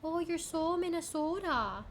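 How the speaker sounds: high, slow, stretched Minnesotan vowels; singsong high-pitched Minnesotan accent. Shown as a demonstration. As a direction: ham up a Minnesotan lilt. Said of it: "like, a Minnesotan accent".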